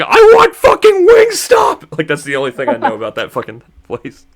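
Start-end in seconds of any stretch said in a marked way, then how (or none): 0.0-2.0 high, drawn-out mock scream; screaming lady imitation. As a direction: scream it like the woman in the clip, slow and very high. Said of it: "that one lady screaming in the car".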